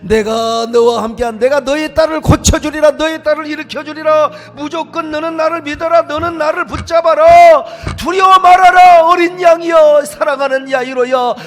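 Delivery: high, loud, rising proclamation; preaching at full force. Acted. Preacher voicing Jesus comforting Jairus, building loud. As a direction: build to a thunderous call.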